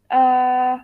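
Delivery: ordinary talk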